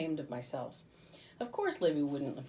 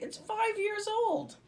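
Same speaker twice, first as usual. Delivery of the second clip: high, slow disbelief; you can't be serious; incredulous; 5 dB louder, slower than usual. A narrator's reading. Livy warning Bob about old licorice, incredulous.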